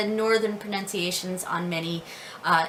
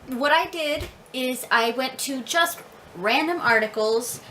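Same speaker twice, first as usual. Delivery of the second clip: ordinary delivery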